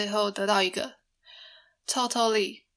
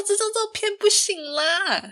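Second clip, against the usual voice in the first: higher, whiny put-on voice; mock whiny protest; 12 semitones higher than usual. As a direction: whine that the photo is no good. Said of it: "我说". (撒娇抱怨，声音拔高)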